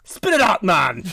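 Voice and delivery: high, loud, exasperated demand; the monk loses patience with the mumbling stranger and yells; impatient shout. Acted. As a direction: bark at him to get on with it.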